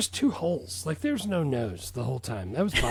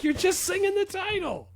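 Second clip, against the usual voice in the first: mock retort. Retorting to mom.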